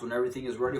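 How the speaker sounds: usual speaking voice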